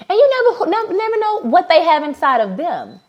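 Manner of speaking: never, never, never rising high and insistent; emphatic never. Real, not acted. Telling listeners anyone could matter, emphatic build on never. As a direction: drive home the never, never, never.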